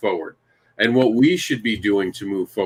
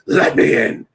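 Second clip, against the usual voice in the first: imitating The Fiend, a creepy breathy whisper; 3 semitones lower, 3 dB louder, slower than usual. Host imitating the wrestler's catchphrase for his podcast friends, creepy whisper. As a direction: hiss "Let me in." like a wrestling villain at the door. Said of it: "Oh my God, that is so creepy and cool".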